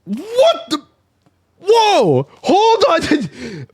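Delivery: very high, startled exclamations. Acted. Bystander hearing a gunshot, high startled outburst. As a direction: cry out in sudden alarm.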